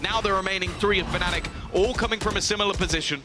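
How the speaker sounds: rising, excited play-by-play call; esports caster. Acted. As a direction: build the hype as the round swings. Live play-by-play casting.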